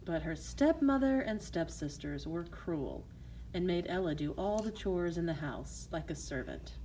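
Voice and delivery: plain reading voice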